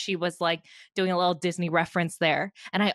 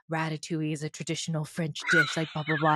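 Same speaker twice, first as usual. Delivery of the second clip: lightly put-on sultry Australian-style chef accent; mock Australian accent; 4 semitones lower, 4 dB quieter than usual. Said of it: "Why did you give him that accent?".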